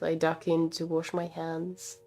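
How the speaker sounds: ordinary talk, nothing marked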